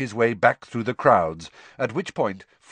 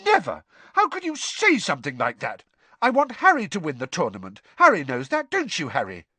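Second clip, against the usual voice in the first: high, indignant protest; exclaim, deeply offended; hurried, heated self-defence; outraged; 7 semitones higher, 3 dB louder than usual. A narrator's reading. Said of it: "looking outraged".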